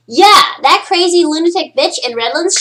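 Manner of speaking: loud, high-pitched imagined listener; mock vindication. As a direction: crow it as if proven right. Imagined listeners later recalling her prediction.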